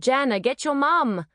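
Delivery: high-pitched urgent cry for help; yell. A narrator's reading. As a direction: yell to fetch a grown-up. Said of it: "and I yelled".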